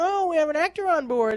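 high-pitched mock cabin announcement; lightly voiced chirpy announcer; stewardess announcement. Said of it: "they made an announcement".

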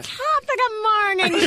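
high, lilting put-on Irish accent; Doctor Licorice. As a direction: greet her as a hammed-up Irish doctor. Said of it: "hear an Irish accent".

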